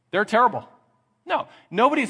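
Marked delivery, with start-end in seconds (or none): 0.1-0.7 lightly acted, higher and louder verdict; mock condemnation from a boss; exasperated boss. Boss judging the always-early employee, mock exasperated.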